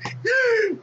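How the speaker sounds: very high shriek of a laugh. Real, not acted. Vlogger cracking up at his own bit, shrieking laugh. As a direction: burst into a squealing laugh.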